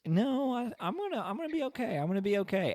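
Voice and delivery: high, fast, nervous little ant voice; squeaky brave ant stammering he'll be fine; high panicky ant insisting he's okay; Flick voice. Retold or imitated. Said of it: "Flick's like".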